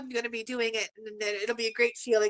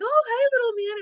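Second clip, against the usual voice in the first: much higher, louder cooing greeting; baby talk; 13 semitones higher, 5 dB louder than usual. Retold or imitated. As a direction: coo at the baby like a doting grandma. Grandma greets baby on video.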